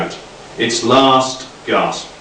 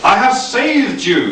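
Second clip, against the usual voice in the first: higher, slow grandiose proclamation; hammed-up dictator; 10 semitones higher, slower than usual. A narrator's reading. Nick to the refugees, hammy grandiose cry. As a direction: proclaim it like a mock tyrant. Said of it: "he cried".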